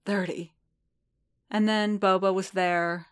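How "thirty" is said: low and broken. A narrator's reading. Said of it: "low and broken".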